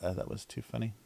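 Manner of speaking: ordinary delivery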